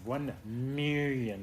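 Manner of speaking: very slow, drawn-out villain voice; Doctor Evil. Acted. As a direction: stretch it out like a hammy supervillain. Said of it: "You know, Doctor Evil".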